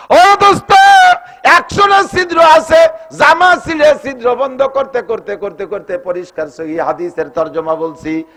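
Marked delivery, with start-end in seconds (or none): 0.1-6.0 high loud call, tumbling down in pitch at the end; full-force preacher's shout, fading; impassioned preaching cry. A narrator's reading. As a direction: cry out to the crowd, then wind down through the repetition.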